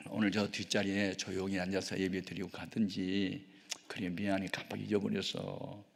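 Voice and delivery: very soft, low coaxing voice; soothing. Retold or imitated. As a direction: placate someone you let down, gently and apologetically. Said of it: "막 달래주는 걸". (나직하게 달래는 말투)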